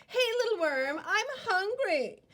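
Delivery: high, sweet, scheming bird; sly bluebird voice. Acted. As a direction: coax the worm high and sugary. Bluebird voice lures worm.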